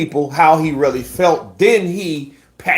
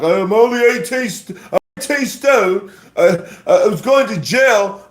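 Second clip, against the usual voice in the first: high whiny mock excuse, higher pitch; 9 semitones higher than usual. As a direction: whine it like a sore loser. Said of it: "Don't give excuses like".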